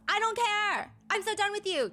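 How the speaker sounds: high, shouted mock fury; hammed-up all-caps rant. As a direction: read it as if typed in caps. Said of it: "responds in all caps".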